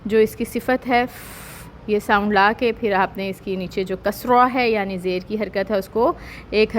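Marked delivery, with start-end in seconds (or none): 1.1-1.4 ف with breathy hams, no voice; voiceless, airy ف; whispered fa. Shown as a demonstration. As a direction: let the air escape on ف. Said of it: "ہمس لانی ہے".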